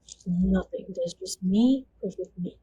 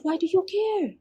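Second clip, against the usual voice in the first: sharp high challenge, pitched up; angry demand; 11 semitones higher than usual. Retold or imitated. Captor challenges the captive woman, high and sharp. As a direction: throw the question back at her. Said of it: "he didn't say it in such nice words".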